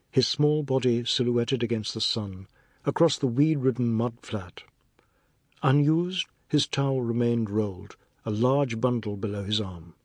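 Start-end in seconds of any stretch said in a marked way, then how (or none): none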